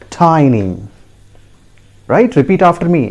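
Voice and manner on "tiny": "tiny" as TY-nee, falling; correct pronunciation. Shown as a demonstration. Teacher telling learners the correct form, "tiny" as TY-nee. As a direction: say "tiny" with an eye vowel. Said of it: "See the phonetic transcription".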